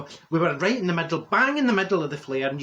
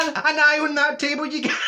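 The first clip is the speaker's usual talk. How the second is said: laughing; 11 semitones higher, 4 dB louder than usual. Real, not acted. Laughing at own pun.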